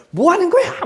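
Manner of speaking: high-pitched indignant demand; a conflict theorist jumping on the teacher; angry confrontation. Acted. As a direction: grab him by the collar and demand. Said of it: "제 멱살 잡아요".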